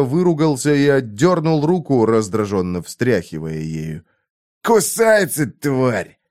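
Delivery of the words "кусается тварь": growling. A narrator's reading. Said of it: "прорычал он".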